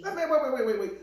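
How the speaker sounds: loud, high-pitched repeated wait; urgent interruption. Real, not acted. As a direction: cut her off loudly to stop her. Cuts in to interrupt.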